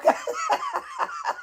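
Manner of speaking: squealing high laugh; a sudden high-pitched cackle of delight. Real, not acted. Laughing at a quoted joke.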